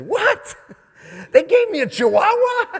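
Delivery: hammed-up outrage at being given a Chihuahua; high shrieking mock surprise; mock shocked squeal. Acting blind man's shock.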